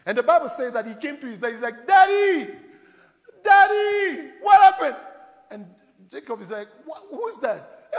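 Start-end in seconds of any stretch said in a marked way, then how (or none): 1.8-5.0 high, loud dramatic wail to Daddy; calling out to dad. Acted. Son shouting to his father for the blessing, high distressed call. 6.8-7.9 lightly acted bewildered dad, higher and slow; slow, raised-pitch mock puzzlement; puzzled father. Father Jacob asking who is calling him, mild bewilderment.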